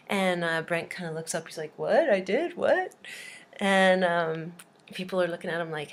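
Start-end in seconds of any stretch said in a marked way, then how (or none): 1.8-2.9 puzzled questions jumping up in pitch; mock baffled. Student singled out by the teacher's story, baffled. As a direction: act caught off guard, rising at the end.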